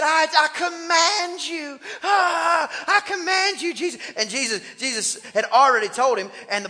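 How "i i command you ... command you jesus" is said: high, drawn-out mock yell; blustering demon making demands; yelling devil. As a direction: act out a strained, hammed-up command. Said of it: "after he yells at him".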